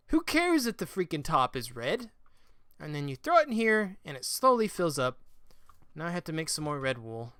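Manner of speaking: ordinary talk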